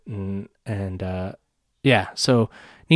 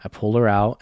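usual speaking voice

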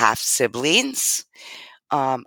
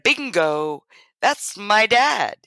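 higher, "Bingo." called out bright; excited bingo; 8 semitones higher, slower than usual. Retold or imitated. She tells her new cousin who her dad was, excited. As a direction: exclaim it with a triumphant lift. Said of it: "That's exactly how I said it".

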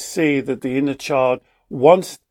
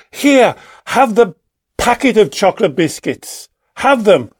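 louder, higher fed-up parent giving in; exasperated parent; 4 semitones higher, 4 dB louder than usual. Retold or imitated. Worn-down parent giving the child the whole packet, fed up. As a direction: throw up your hands and hand over the packet.

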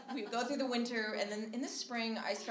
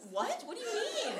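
high, baffled laugh; high laughing confusion; confused; 12 semitones higher than usual. Retold or imitated. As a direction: caught off guard. Shocked roommate doesn't know about car.

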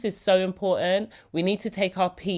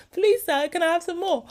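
begging orphan; 10 semitones higher than usual. Retold or imitated. Quoting Oliver Twist begging.

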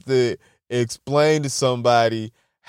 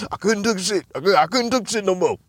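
mock country worker quitting; country slang voice; 3 semitones higher than usual. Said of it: "sounds like some country ass slang".

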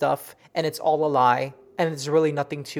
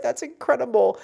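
amazed; 7 semitones higher than usual. Retold or imitated. Retelling her amazed reaction.

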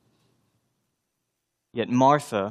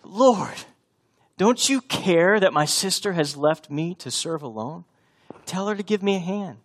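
a lighter, less shrill retake of the plea; toned-down distressed Martha; 7 semitones higher than usual. Acted. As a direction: repeat the complaint with just a hint of distress. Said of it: "my best woman in distress voice".

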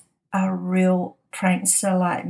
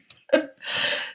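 a soft, airless laugh at the memory; breathy laugh; 4 dB quieter than usual. Real, not acted. Podcaster laughing at her own office prank story, soft breathy laugh. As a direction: let a quiet laugh slip out, almost silent.

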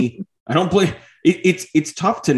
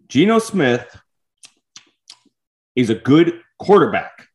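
chewing while talking; 4 semitones higher than usual. Real, not acted. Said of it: "Sorry for the chewing sounds".

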